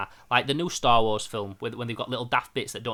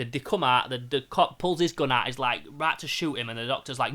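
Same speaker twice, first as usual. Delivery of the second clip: usual speaking voice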